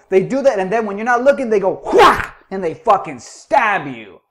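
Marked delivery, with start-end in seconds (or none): 1.9-2.4 a higher, loud sharp whack; a sudden mock whack; mock whack sound. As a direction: smack out a stabbing noise. Mimicking a stab attack.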